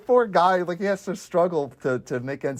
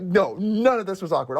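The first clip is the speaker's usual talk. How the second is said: usual speaking voice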